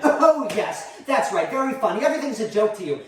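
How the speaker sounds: high, loud start, easing slightly; offended sarcasm. Acted. Mother to child who finds it funny, offended. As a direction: answer laughter with wounded sarcasm. Mother reacts to being laughed at.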